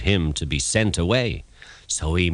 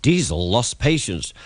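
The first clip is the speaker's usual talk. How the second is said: ordinary delivery, nothing marked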